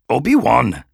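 loud sharp call for attention. Acted. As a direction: snap him back to attention. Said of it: "said Yoda".

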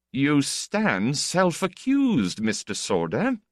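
drawn-out teasing accusation; slow mock accusation. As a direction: accuse him slowly, in jest. Heleskar slowly mocking.

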